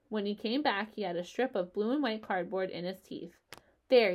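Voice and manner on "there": mildly triumphant announcement; pleased little flourish. A narrator's reading. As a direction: present it with a touch of triumph. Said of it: "he said triumphantly".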